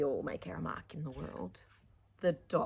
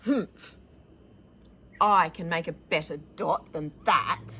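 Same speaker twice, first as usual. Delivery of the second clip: scoffing; 11 dB louder than usual. Acted. Vashti scoffs at her dot.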